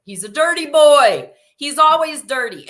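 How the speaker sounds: put-on high, loud teasing child shout; yelling brother. Brother yelling from the chair about Quincy, teasing shout. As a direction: yell it across the room to tease. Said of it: "his brother yells from the chair".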